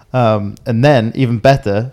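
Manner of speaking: ordinary delivery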